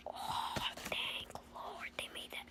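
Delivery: breathy relieved whisper. Retold or imitated. Kid whispering thanks about Cars Shorts.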